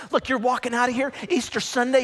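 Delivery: usual speaking voice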